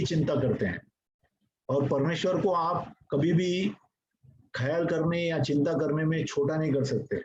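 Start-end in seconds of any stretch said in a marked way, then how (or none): none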